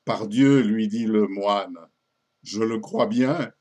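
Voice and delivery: loud, deliberate reply, drawn out at the end; emphatic monk's admission; monk confessor in verse. Acted. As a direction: declaim the monk's punchline slowly and firmly.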